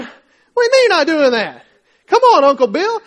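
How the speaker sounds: loud, high-pitched incredulous outburst; outraged protest. Acted. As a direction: shout in exasperation at a stubborn relative. Acted outraged reply to uncle.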